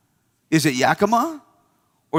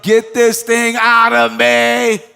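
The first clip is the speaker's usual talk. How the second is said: slow, loud, strained hammed-up plea; desperate pregnant woman; 6 semitones higher, 7 dB louder, much slower than usual. Preacher playing a woman in her eighth month, slow loud hammed-up plea. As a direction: drag it out like you can't take it anymore. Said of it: "you're like".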